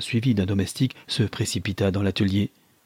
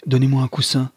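urgent demand; 4 semitones higher, 5 dB louder than usual. A narrator's reading. Frantic husband demands help.

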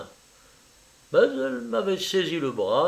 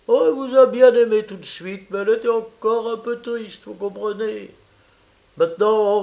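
higher, effusive Italian mother welcoming a guest; eager landlady mother; 5 semitones higher, 5 dB louder than usual. Acted. Mother welcoming her daughter's French lover, eager and high. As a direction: gush over the visitor, pitched up.

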